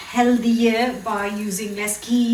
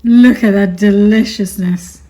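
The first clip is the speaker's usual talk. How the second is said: louder, punching the word look; loud excited exclaim; 11 dB louder than usual. Real, not acted. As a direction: call it out with delight. Louder repeat, admiring the food.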